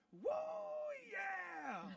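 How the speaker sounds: high, loud whooping holler; retold rally shout. Guest retelling Howard Dean at his rally, hollering shout. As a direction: whoop it out like a candidate firing up a crowd. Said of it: "he shouted".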